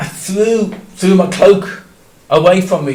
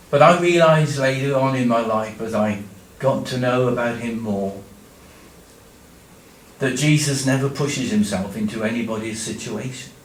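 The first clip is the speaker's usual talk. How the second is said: ordinary talk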